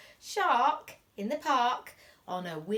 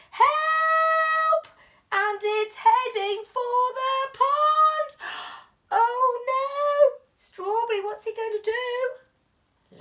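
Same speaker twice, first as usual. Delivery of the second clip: high, loud, urgent cries; alarmed excitement; 13 semitones higher, 8 dB louder, slower than usual. Acted. Teacher reading to children, crying out in alarm. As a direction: sound the alarm for the runaway pram.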